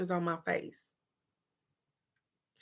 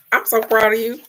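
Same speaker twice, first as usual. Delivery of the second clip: louder, rising cheer of pride; excited praise; 4 semitones higher, 7 dB louder, faster than usual. Real, not acted. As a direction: cheer her on proudly. Cheering on her friend.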